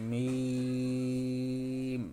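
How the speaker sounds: মিম held long and drawn out very slowly; Arabic letter demo. Shown as a demonstration. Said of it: "উচ্চারণ হবে দীর্ঘ সময়". (মিম দীর্ঘ করে টেনে উচ্চারণ)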